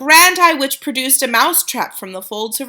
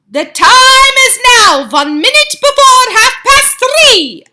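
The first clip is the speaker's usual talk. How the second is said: high, loud, slow witch announcement; announcing witch; 11 dB louder, slower than usual. A narrator's reading. Grand High Witch announcing the time to the witches. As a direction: proclaim it like a gloating witch. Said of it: "announced the Grand High Witch".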